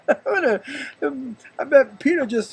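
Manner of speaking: slow, laughing through words. Real, not acted. Imagining Peter called Satan, laughing. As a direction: giggle as you picture it.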